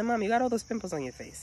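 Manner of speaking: a hint of a child's voice; imitating young son. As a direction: lightly mimic your kid pointing something out. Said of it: "You know what my son said?".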